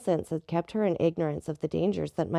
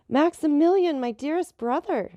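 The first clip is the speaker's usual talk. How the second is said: high, loud cry of joy at seeing her brother; a sister's delighted cry, pitched high and loud; joyful cry; 9 semitones higher, 4 dB louder than usual. A narrator's reading. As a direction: call out his name in glad surprise. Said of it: "she cried".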